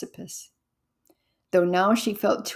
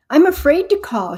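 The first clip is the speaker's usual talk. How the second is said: louder, higher, breathless cry of fear; gasped plea; 7 semitones higher, 7 dB louder than usual. A narrator's reading. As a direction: blurt it out, gasping with fear. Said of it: "she gasped".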